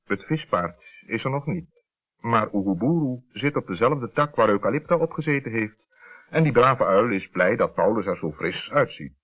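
ordinary delivery

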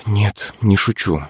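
slow, breathed-out admission; slow, sighing confirmation; exhaled words. A narrator's reading. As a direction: let it out on a sigh. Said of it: "выдохнул в динамик Мишка".

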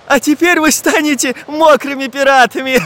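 high jeer ending in laughter; mock them and break into a laugh; laughing taunt. A narrator's reading. Boy taunts the falling pirates, laughing. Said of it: "засмеялся Чижик".

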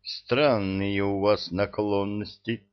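ordinary delivery

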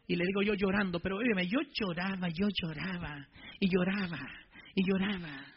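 low, soft testimony of weeping; hushed recollection. Retold or imitated. Preacher recalling how he wept, hushed and low. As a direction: drop right down, repeating it quietly.

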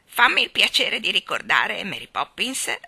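loud, haughty rebuke; icy retort. A narrator's reading. Starling rebukes Mary Poppins, icy retort. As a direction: retort with offended dignity, starting loud. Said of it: "ribatté in tono glaciale".

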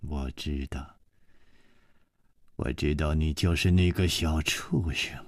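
low, soft contemptuous voice; cold hard woman. Acted. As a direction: spit contempt, low and quiet. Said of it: "声音忽然充满了愤怒".

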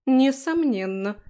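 drawn out, pompous certainty; self-important. A narrator's reading. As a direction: say it with grand importance. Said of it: "важно кивнула та".

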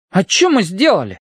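high, silly teasing question; goofy kids. A narrator's reading. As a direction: play the fool, asking innocently. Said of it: "дурашливо спросили они разом".

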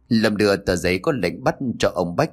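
plain reading voice

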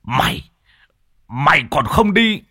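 high, loud commanding shout at the demon; furious shaman's roar; shouting exorcist. Acted. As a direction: bark orders to drive the spirit down. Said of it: "quát lên".

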